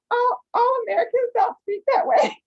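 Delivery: high, loud, laughing disbelief; amused protest. Retold or imitated. Actor to casting people about the cowboy accent, amused high-pitched protest.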